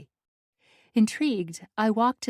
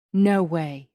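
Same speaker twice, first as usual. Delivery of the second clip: plain reading voice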